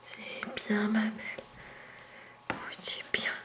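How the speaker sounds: soft whispered praise to a cat; whispering. Real, not acted. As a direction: hush it out gently. Soothing cat softly.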